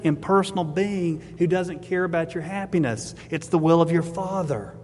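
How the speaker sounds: ordinary talk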